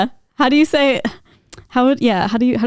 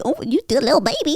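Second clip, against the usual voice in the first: put-on cooing baby voice at the cats; lightly voiced cutesy pet talk; 4 semitones higher than usual. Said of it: "we're, like, different people".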